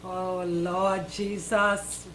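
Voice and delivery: ordinary delivery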